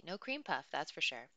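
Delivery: plain reading voice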